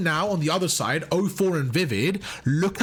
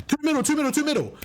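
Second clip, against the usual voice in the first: higher, quick repeated comms callout; urgent mock callout; 9 semitones higher, faster than usual. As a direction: bark it out like a teammate mid-fight. Imitating a quick team callout.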